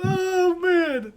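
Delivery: high laughing "oh man". Real, not acted. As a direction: cry out laughing. Laughing after the bit.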